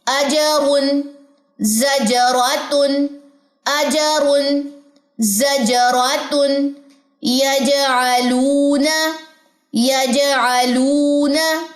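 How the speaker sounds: अजरुन जजरातुन यजअलूना drawn out very slowly; slow recitation. Shown as a demonstration. Teacher reciting Quranic examples slowly for students. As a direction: recite each word very slowly with bounce. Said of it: "examples देखिए".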